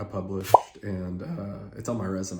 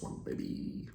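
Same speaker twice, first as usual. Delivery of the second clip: whispering; 15 dB quieter than usual. Real, not acted. Pumping himself up before interview.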